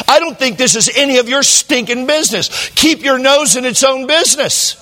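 hammed-up offended friend; retold indignation. Friend scolding the pastor over his faith, high and indignant.

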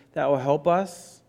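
ordinary talk, nothing marked

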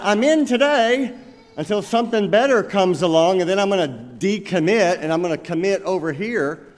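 high, loud mock of a flaky member; sing-song brush-off; tossing off a commitment like it's nothing; fickle quitter's voice. Mocking a fickle church member.

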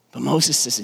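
normal talking voice

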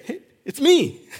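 high, laughing, jokey hey; mock casual greeting. Preacher jokes about a casual Jesus, laughing. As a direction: toss it off playfully through a laugh. Joking what Jesus could say.

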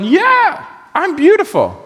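mock-vain woman's boast; sassy self-praise. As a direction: preen like she knows it. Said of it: "you might think she's going".

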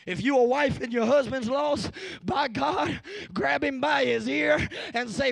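rally the congregation with rising force; rousing preaching. Real, not acted.

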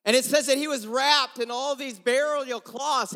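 ordinary talk

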